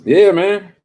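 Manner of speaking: high, loud yeah man; excited outburst. Real, not acted. Hyping up the chat, loud and high. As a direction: burst out hyped.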